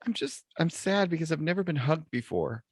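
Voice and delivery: high-pitched, mildly sad pleading voice; mock tearful. Crying gal on the beach answers the lifeguard, mock tearful. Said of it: "and she's crying".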